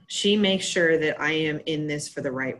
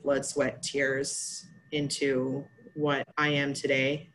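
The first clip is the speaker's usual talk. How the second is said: ordinary talk, nothing marked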